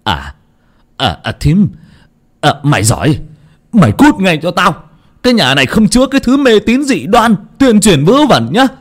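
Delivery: stammering rage, high and loud; old man roaring at his sister-in-law; furious yell. Acted. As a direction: bellow at her to get out. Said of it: "đưa tay đập vào giường mà quát".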